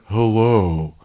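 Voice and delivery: stretched, slowed-tape caller; slowed-down weirdo. Retold or imitated. Kevin booking a hotel room, weird slowed-down voice. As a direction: draw it out like a tape playing slow. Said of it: "like a weirdo".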